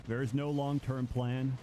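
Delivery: ordinary delivery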